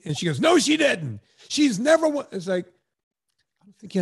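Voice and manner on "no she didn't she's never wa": imitating her, higher and louder, a sharp protest; mock outraged friend cutting him off; indignant denial. Retelling how his friend snapped back in denial, a mock indignant protest. Retold quarrel with left-wing friend.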